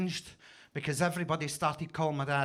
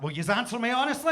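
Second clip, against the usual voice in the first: louder, higher repeat; 6 semitones higher, 4 dB louder than usual. Pretend. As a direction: push the question harder, laughing. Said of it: "I need more than that".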